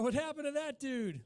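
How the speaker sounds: ordinary talk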